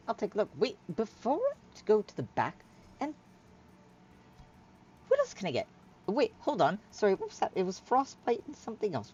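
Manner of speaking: high-pitched, busy, flustered voice; scatterbrained gnome shopkeeper. Acted. Gnome forgetting a customer's order, flustered.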